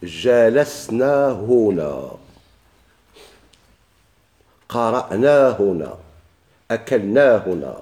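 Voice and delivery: slow, measured examples; slow example drill. Shown as a demonstration. Teacher giving students example sentences, slow and measured. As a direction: lay out each example slowly. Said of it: "قل".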